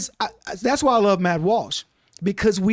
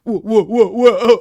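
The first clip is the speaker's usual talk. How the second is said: loud, laughing run of whoas; 8 dB louder than usual. Pretend. Acting out the witness being caught in the lie, loud laughing whoas. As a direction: pile on the whoas while cracking up.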